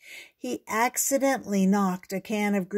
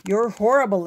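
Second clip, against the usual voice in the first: louder, hurt exclamation; exclaimed; 3 semitones higher, 7 dB louder, faster than usual. Acted. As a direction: fling it at him as she storms off. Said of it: "exclaimed Susie".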